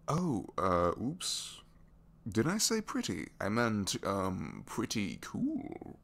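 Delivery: flustered backpedal. Acted. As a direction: blurt it, then scramble to cover the slip. Backpedalling after calling listener pretty.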